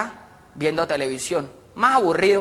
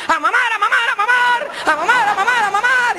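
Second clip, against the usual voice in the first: high, loud sing-song call; 5 dB louder than usual. Acted. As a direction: yell the signal to the whole troop. Shouted call to suckle.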